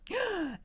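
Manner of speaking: sharp high gasp of shock. Real, not acted. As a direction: gasp at the spoiler. Chat spoils the book.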